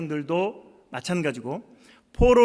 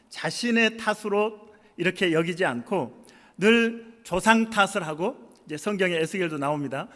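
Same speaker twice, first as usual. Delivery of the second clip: ordinary talk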